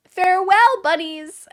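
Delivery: loud, high, drawn-out send-off; mock farewell. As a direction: call out a hammed-up goodbye. Mock send-off to abandoned bunny boys.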